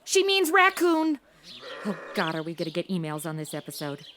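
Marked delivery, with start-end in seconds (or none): 1.9-4.1 fast muttered aside. Acted. Aside about the show.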